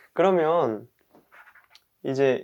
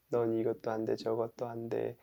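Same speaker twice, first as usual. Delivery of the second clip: self-insulting; 2 semitones lower, 10 dB quieter than usual. Pretend. Person who overslept insults themselves, muttered scolding. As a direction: grumble insults at yourself, much softer. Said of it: "막 욕하는 거예요".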